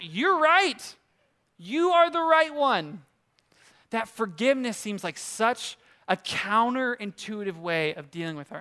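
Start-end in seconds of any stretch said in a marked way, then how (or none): 0.0-3.0 higher, slow mock-indignant you're right; mock self-righteous. Preacher mocking the angry listener's pride, higher and slow. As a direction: ham up the wronged party.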